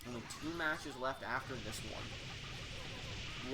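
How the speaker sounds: lingering, hushed repeat of the line; drawn out. Real, not acted. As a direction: stretch the words out slowly.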